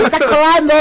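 high, loud delighted exclamation; excited fan. Retold or imitated. People in line spotting the comedian, high loud excitement. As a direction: point her out with glee. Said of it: "en vez de".

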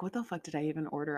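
fast under-the-breath grumble; muttering to herself. Retold or imitated. Looking at her Amazon boxes, quick soft mutter. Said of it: "I'm like".

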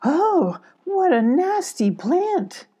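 high, slow exclamation of disgust; mother badger recoils from a thorny plant, crying out high; mock cry of dismay. A narrator's reading. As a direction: cry out at the nasty plant, drawn out. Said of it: "Alana cried".

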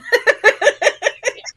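high, loud giggling burst; 1 loud laugh. Real, not acted. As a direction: laugh hard at the joke. Laughing at One Direction choice.

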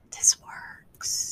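breathless hushed whisper. Retold or imitated. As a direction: whisper it in quiet awe. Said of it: "and I'm like".

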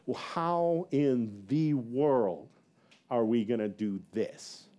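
very slow, drawn-out dismay; dismayed freak-out. Retold or imitated. Pastor secretly freaking out over the move, dragged-out dismay. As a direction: stretch each word in mild mock despair. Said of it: "I'm just freaking out".

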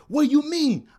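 imitating a high, loud protest; indignant coworker. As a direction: throw it back, high and loud. Coworker's retort in a quarrel.

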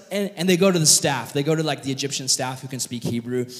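normal talking voice

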